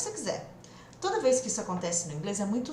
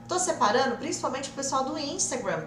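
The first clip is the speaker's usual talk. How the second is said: normal talking voice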